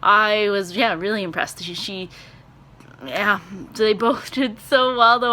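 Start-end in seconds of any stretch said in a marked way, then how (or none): none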